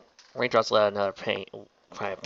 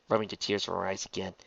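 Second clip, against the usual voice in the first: ordinary delivery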